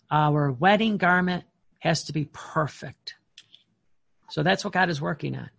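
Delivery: ordinary talk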